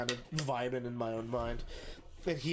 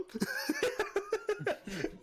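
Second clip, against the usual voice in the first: real high-pitched laugh; long, high giggle. Real, not acted. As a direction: crack up at your own punchline. Laughs at own story's punchline.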